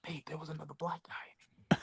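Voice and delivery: hushed secret whisper, no voice. Retold or imitated. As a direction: whisper it to a passerby as if gossiping. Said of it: "you can almost hear them whispering".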